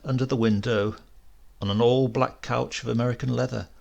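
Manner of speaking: plain reading voice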